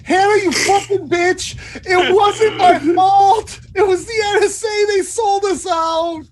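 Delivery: very high, loud wailing scream; hysterical scream. Acted. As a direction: scream it out like a man freaking out. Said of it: "He screams out in Yiddish".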